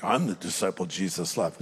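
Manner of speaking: low, soft smug boast; lightly mock a bragging disciple; boastful. Preacher voices John as if bragging, mock smug. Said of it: "That almost might sound boastful".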